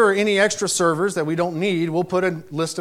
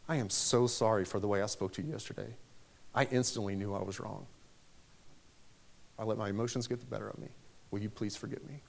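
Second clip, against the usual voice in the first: much softer, low and sincere; soft humble apology; 9 semitones lower, 15 dB quieter than usual. Retold or imitated. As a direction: say sorry quietly, almost under your breath. Sincere apology to the angry server.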